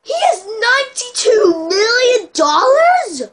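high, loud, slow disbelief; astonished. Real, not acted. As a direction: gasp it out amazed. Stunned by rival's money.

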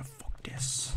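breathy whispered fuck this; a voiceless aside to himself; whispered curse. Real, not acted. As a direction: hiss it under your breath. Muttering in frustration mid-game.

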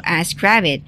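ordinary delivery, nothing marked

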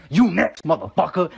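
loud, high-starting mock threat; punchy threat in an imagined dog's voice; threatening dog's voice. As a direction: menace them as the humping dog. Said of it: "I assume he was saying".

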